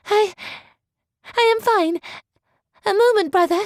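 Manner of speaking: put-on nervous girl calling out; flustered young girl. A narrator's reading. Georgiana flustered answer.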